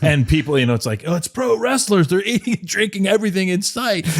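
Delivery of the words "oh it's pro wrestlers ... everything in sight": high-pitched, amused imitation of gawkers; mock excited onlookers. Onlookers gawking at pro wrestlers in a restaurant, mock excited. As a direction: imitate amazed people watching, voice pitched way up.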